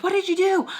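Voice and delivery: high, loud retold outcry; alarmed demand. Mister Peach rushing into the room, alarmed high demand. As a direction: burst in demanding an answer.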